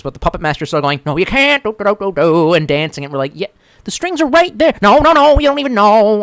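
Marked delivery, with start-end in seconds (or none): 1.1-2.5 high, sing-song puppet master taunt; mock puppet master. Taunting the crowd as a puppet master, high sing-song. 3.3-6.2 high, loud, jeering; mocking crowd. Crowd jeering at the puppet master, high and mocking.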